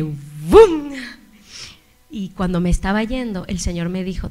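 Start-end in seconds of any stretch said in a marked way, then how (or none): 0.0-0.9 shouted burst of a word; loud bum. Retold or imitated. Preacher marking the moment it all hit, shouted. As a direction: hit it like a sudden blow. Sudden impact in the story.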